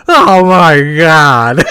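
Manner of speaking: loud, very drawn-out mock cry of horror; retold outcry. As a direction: imitate a pilot's long, loud wail. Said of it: "And he just hear him go".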